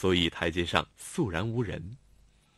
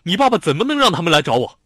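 building into a fast, much louder snarl; vicious retort; 5 semitones higher, 10 dB louder, faster than usual. A narrator's reading. Xiao Tong accuses Lanlan about her father, viciously. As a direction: spit the accusation out, getting angrier. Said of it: "恶狠狠地看着他".

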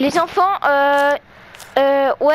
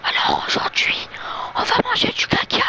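mock ASMR whisper; playful fake ASMR, close and hushed; right in your ear; 9 semitones higher than usual. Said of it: "faut faire de l'A S M R".

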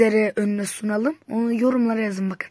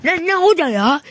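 indignant protest; 7 semitones higher, 6 dB louder than usual. Real, not acted. Protesting referee call.

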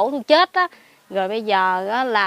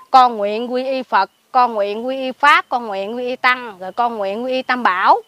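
lightly chanted prayer for a dead dog's spirit; prayer recitation; 3 dB louder than usual. Retold or imitated. Recites a Buddhist vow for the dog.

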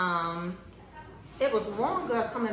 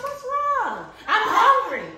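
exasperated; 13 semitones higher, 8 dB louder, slower than usual. Retold or imitated. Night argument with grandma.

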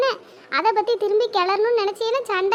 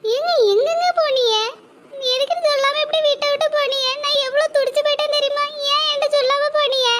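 higher, tearful questions to a husband who left without a word; anxious pleading; 5 semitones higher than usual. Acted. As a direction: beg him, hurt and frightened, to say where he went. Wife confronts returning husband, distressed.

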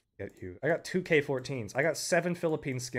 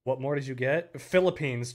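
ordinary talk, nothing marked

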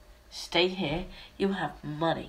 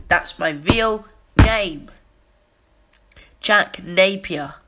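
loud, slow insistence; forceful declaration; 2 semitones higher, 9 dB louder than usual. Acted. Clown insisting on his real name, loud and slow.

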